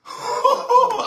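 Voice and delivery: a short high burst of real laughter. Real, not acted. Preacher laughs mid-story. (risa alta y breve)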